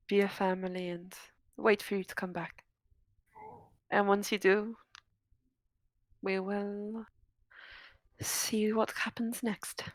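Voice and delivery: soft tender whisper. Acted. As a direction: whisper it like a goodbye. Tender parting words, whispered.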